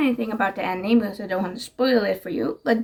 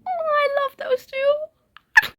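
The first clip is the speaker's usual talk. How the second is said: very high, drawn-out gushing; slow, high-pitched fangirl squeal; slower than usual. Real, not acted. Giggling over favorite book pair.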